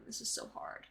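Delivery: breathy whisper to herself; whispered aside. Real, not acted. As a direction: whisper it under your breath. Frustrated aside under breath.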